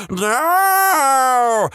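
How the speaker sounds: long, wailing, high "no"; screamed no. A narrator's reading. Five-year-old startled by cousin's eye, screamed wail. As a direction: cry it out in drawn-out horror. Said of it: "but I screamed".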